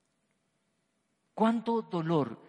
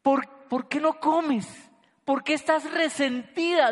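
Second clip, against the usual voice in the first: higher, slow bewildered questioning; puzzled husband; 8 semitones higher, 4 dB louder, slower than usual. Retold or imitated. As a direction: ask slowly, baffled, pitched up.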